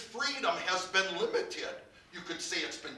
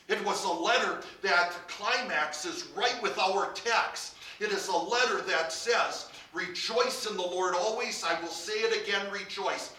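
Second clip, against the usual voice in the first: louder, ringing pulpit proclamation; preaching at full force; 3 semitones higher, 7 dB louder than usual. Acted. Preacher lifting his congregation with the verse, full-force preaching.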